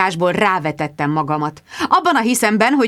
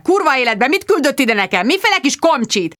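angry phone yell; 6 semitones higher than usual. Acted. Squire yells at Finch about the reporter, furious. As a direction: rage at the producer. Sir Hugo yelling on phone.

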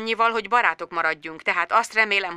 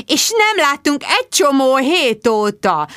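slow, higher reproach; reproachful; 7 semitones higher, 3 dB louder, slower than usual. A narrator's reading. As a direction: chide him for staying away. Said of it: "szemrehányóan". (szemrehányó hangon)

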